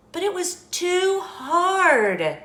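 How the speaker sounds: high, slow, loud complaint; storybook complaint. A narrator's reading. Goldilocks tries the great big chair, dramatic complaint. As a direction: draw out the complaint, pitched high.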